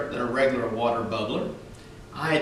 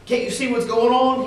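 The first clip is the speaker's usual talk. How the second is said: higher, louder policeman shout; 8 semitones higher, 5 dB louder than usual. Acted. Policeman shouting at the children by the fountain, loud. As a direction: yell it down at the kids. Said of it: "he shouted".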